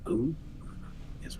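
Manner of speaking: ordinary delivery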